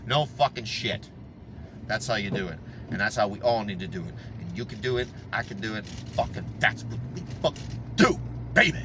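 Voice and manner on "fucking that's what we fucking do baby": breathy hushed words to himself; whispered aside. Real, not acted. Driver mutters his creed to himself in a whisper. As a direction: drop to a near-whisper.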